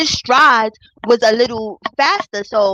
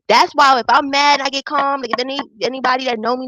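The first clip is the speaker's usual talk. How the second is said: ordinary talk, nothing marked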